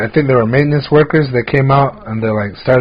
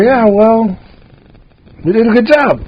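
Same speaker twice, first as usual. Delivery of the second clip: higher, put-on smug voice; mock proud; 10 semitones higher than usual. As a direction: play it cheeky and self-satisfied. Proudly justifying taking tips.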